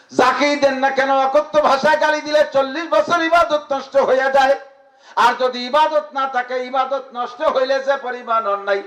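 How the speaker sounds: rousing high preacher voice; fiery preaching. Acted. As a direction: preach it out to the crowd. Preaching at full force.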